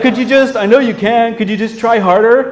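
high, pushy plea to try harder; pleading user. Retold or imitated. User begging the chatbot to try harder, high pleading whine. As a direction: needle the AI like an exasperated user.

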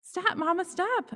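put-on high child's shout. A narrator's reading. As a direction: cry out to stop her. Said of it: "I shout".

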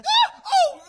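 shrieks in fright, then laughs; startled scream. Acted. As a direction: jump out of your skin. Startled by someone sneaking up.

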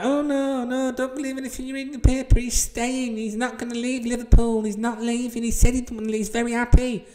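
sneering impression of naive Liverpool fans in denial; mocking fans. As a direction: parody the gullible fans insisting he'll stay. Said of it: "they're all like".

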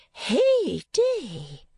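high surprised cry of Hey day; high little cry of astonishment; cried out. A narrator's reading. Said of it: "cried Miss La Creevy".

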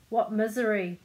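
loud storybook exclamation; crying out. A narrator's reading. Philosopher to little man about the sea trip, crying out. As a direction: cry out in dismay. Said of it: "cried the philosopher".

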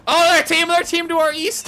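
high urgent callout; excited callout. Real, not acted. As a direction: call out the enemy team loudly. Spotting enemies, calling out.

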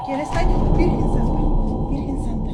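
loud, scared cry of alarm; frightened shout. Real, not acted. Woman alone at home as the suitcase moves: frightened shout. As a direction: yell out in real fright at a noise.

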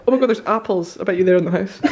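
normal talking voice

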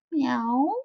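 high rising meow; a sleepy kitten's mew; cat meow. Acted. The cat curled beside Mister Flugel, a high meow.

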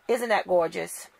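usual speaking voice

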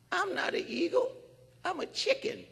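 high, small voice insisting; a meek bird protesting; timid bird voice. Retold or imitated. The bird insisting to the man it is a chicken.

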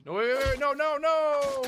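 high, loud cries stretching out the last no; panicked shouting. Real, not acted. Player begging it to stop, panicked shouting. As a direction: yell frantically to stop it. Panicking as game goes wrong.

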